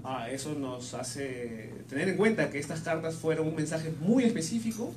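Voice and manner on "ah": ordinary delivery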